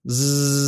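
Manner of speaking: long, continuous buzzing "Z" sound; stretched-out z. Shown as a demonstration. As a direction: hold the voiced zzz on one level note. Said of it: "stretch it out, like this".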